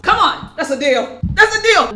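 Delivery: loud, high bargain cheer; excited cheer. Real, not acted. To viewers about a camera discount, excited shout. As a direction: hype up the deal, shouting.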